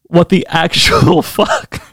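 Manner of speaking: slow, laughing what the; stunned laugh mid-question; laughing disbelief. Real, not acted. Reacting to absurd lyric.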